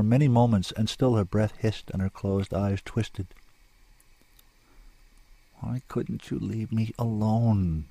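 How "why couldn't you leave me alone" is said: slow, subdued lament; weary question. A narrator's reading. Rescued woman lying on the shore to her rescuer, slow weary misery. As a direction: ask it slowly, drained of all fight. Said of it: "she asked at last".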